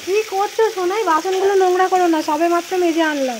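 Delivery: loud real parental call. Real, not acted. Calls out to child, scolding gently.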